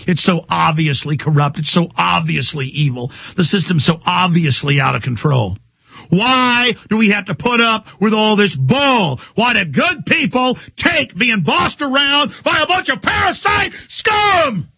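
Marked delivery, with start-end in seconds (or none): 6.1-14.7 rising into a high, cracking yell, slowing at the end; furious shout. Real, not acted. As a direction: let the rage break loose on the last words. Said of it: "I'm really in a nasty mood today".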